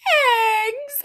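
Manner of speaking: high shrieking cry of eggs; screamed. A narrator's reading. Narrator screams at her brothers about the eggs. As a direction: scream it out in alarm. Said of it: "I screamed".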